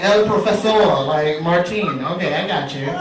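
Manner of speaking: ordinary delivery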